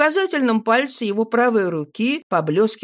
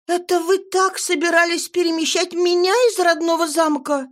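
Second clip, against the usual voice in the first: horrified; 10 semitones higher than usual. A narrator's reading. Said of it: "ужаснулась я".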